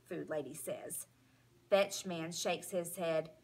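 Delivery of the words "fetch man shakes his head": usual speaking voice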